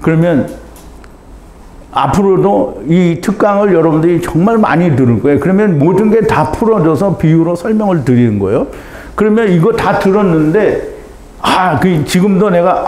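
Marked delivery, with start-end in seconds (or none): none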